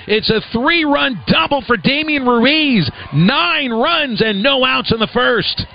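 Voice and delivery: high, drawn-out excited call; excited play-by-play call. Real, not acted. Announcer calls a three-run double to listeners, excited. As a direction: ring out the big play, pitch held high.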